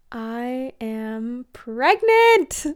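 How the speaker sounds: very slow, deliberate reveal; slow announcement. Real, not acted. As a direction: drag out the big news. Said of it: "I am actually so nervous".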